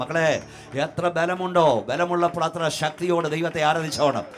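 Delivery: impassioned preaching. Real, not acted. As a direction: build from forceful preaching to a high shouted command. Preaches at mounting full force. (ആവേശത്തോടെ ഉച്ചത്തിലുള്ള പ്രസംഗം)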